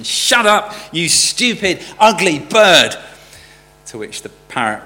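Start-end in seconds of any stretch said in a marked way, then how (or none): 0.0-3.0 loud, high-pitched exasperated outburst; frustrated shout. A narrator's reading. Repairman fed up with the parrot's name-calling, shouting in frustration. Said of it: "he shouted in frustration".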